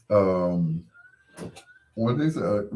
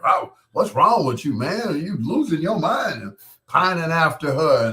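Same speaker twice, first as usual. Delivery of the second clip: higher-pitched mock outrage; retold indignant scolding; 6 semitones higher than usual. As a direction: imitate an upset friend scolding. Retelling an upset critic's outburst.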